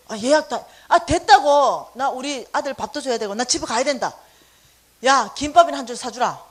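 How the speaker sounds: loud, snappish brush-off; refusing the friend in a huff; mock irritated outburst; retold temper. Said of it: "왜 성질 내고 그러냐".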